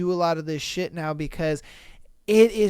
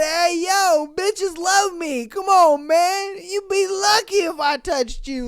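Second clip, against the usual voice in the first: much higher, hammed-up cocky yell; mock the guy screaming across the lot; mock arrogant creep; 10 semitones higher than usual. Mocking the man yelling at her in a parking lot, higher hammed-up voice.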